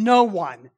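NO ONE hit firmly; firm stress on NO ONE; emphatic. Shown as a demonstration. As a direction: punch NO ONE as a flat denial. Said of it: "he's emphasizing".

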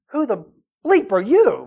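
mock rude interrogation; hostile challenge. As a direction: demand it like they don't belong. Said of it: "That's, that's the approach".

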